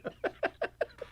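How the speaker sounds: airy squeak of a laugh; breathless high giggle. Real, not acted. Laughs at own voice slip.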